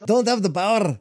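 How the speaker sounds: high, loud Scotty voice; Scottish engineer protesting he has no power; Scottish accent. Pretend. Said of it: "I'm good with accents".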